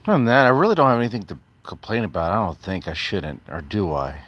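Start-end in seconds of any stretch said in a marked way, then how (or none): 0.1-2.3 fast, clipped rush of words. Real, not acted. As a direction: rattle it off fast. Rushed sarcastic claim.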